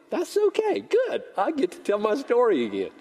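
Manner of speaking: squeaky high little aside; very high, playful consolation; mock-cheerful relief. Preacher to congregation as few hands go up, mock-cheerful relief.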